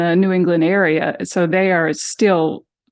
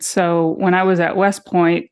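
usual speaking voice

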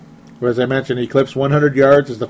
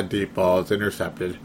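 ordinary talk, nothing marked

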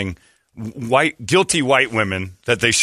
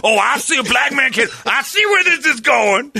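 Donnell Rawlings; 13 semitones higher than usual. Retold or imitated. Comedian baiting a host, high accusing shout. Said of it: "he goes".

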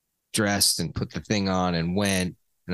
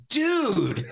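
exasperated; 12 semitones higher than usual. Retold or imitated. Retold reaction to brother's touch.

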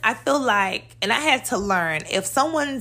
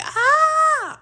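top-of-lungs scream. Shown as a demonstration. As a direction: let out one piercing yell at full lung. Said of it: "I'm not screaming to the top of my lungs like".

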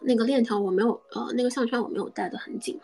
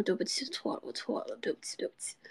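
retold sorry, breathed out almost voiceless; a soft, voiceless mock-contrite sorry to her boyfriend; hushed apology; 9 dB quieter than usual. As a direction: mumble the apology softly, half in a whisper. Apologizing while licking, retold.

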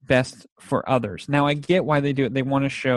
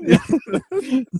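high, loud laugh at his own squeak; 12 semitones higher, 4 dB louder than usual. Real, not acted. After mimicking his daughter, a high laugh.

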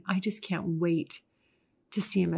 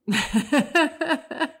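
laugh; 9 semitones higher, 6 dB louder than usual. Real, not acted. Laughing after "they love that.

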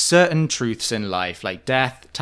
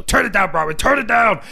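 higher, louder scolding, rising at the end; hammed-up telling-off of a rival; mock scolding; 9 semitones higher, 8 dB louder than usual. Co-host imitates Vicky scolding Bronwyn, mock angry scolding. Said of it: "she lays into Bronwyn".

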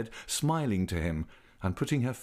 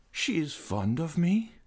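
sighed; 8 semitones higher than usual. A narrator's reading. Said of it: "sighed the young man".

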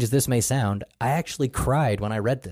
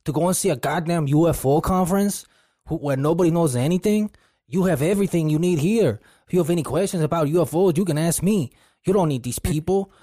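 put-on Spanish accent; 6 semitones higher than usual. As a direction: scold him in a thick Spanish accent. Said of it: "In his thick Spanish accent".

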